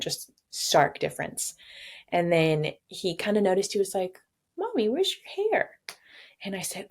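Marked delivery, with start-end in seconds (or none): 4.6-5.8 put-on very high, curious little-boy voice; toddler voice. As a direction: ask it like a puzzled toddler. Toddler son's voice quoted.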